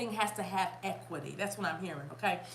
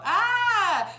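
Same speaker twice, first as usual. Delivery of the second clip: high, loud drawn-out "ah"; excited cry; 10 semitones higher, 9 dB louder than usual. Real, not acted. Host hit by the lyric's message, loud cry. Whoop while channelling lyric.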